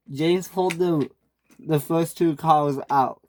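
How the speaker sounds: ordinary delivery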